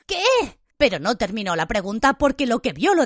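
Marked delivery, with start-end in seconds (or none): 0.1-0.6 high, startled blurt; startled, pitched-up question; alarmed question. A narrator's reading. Harry cuts in, alarmed.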